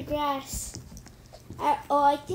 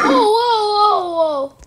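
frightened gasp and cry; 4 semitones higher, 10 dB louder than usual. Real, not acted. Monster appears suddenly.

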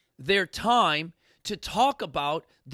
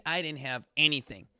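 usual speaking voice

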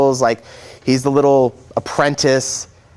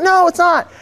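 contradicting voice; 13 semitones higher than usual. Acted. Another figure contradicts.

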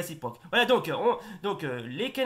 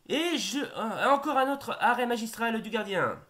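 excited sports commentary; 6 semitones higher than usual. Acted. Commentates a save.

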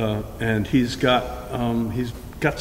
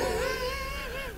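short high burst of laughter; 10 dB quieter than usual. Real, not acted. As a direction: laugh lightly, pitched up. Laugh at Mike's habits.

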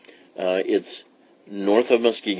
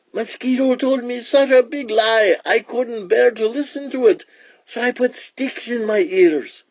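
very high-pitched iguana voice; explaining iguana; 13 semitones higher than usual. Acted. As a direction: pour out an excuse in a high creature voice. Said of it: "said the iguana".

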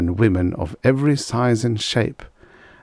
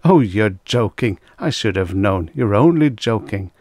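usual speaking voice

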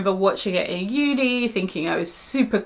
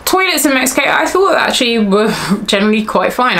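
higher, louder note of real surprise; surprised; 3 semitones higher, 5 dB louder, faster than usual. Real, not acted. Vlogger reviewing toilets in Mexico, sounding surprised. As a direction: sound surprised by your own verdict. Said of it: "why I sound so surprised".

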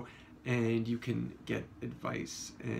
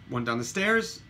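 ordinary talk, nothing marked

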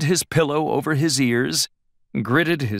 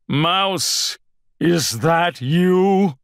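slow, higher wary question; uncertain calling; 6 semitones higher, 4 dB louder, much slower than usual. A narrator's reading. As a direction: call out into the dark uneasily. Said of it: "didn't sound very certain".

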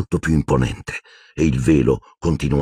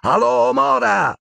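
slow, high, drawn-out spell call; intoning an incantation; slower than usual. A narrator's reading. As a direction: chant the spell out. Casting a spell.

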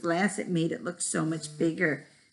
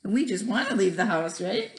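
ordinary delivery

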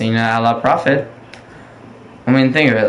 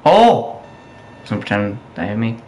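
usual speaking voice

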